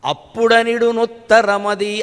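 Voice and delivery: slow chanted poem line; verse recitation. Acted. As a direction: draw the verse out slowly like a recital. Continues the verse. (నెమ్మదిగా పద్య పఠనం)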